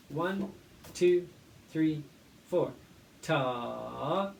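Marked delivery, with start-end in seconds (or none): 0.1-2.7 steady count-in, one to four; teacher counting the class in. Shown as a demonstration.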